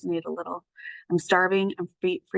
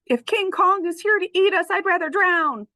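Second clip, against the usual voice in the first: much higher, louder panicked voice; screaming beagle; 12 semitones higher, 5 dB louder than usual. Acted. Beagle screaming at giant ape, panicked high shriek. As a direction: shriek in panic like a frightened dog. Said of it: "screams the beagle".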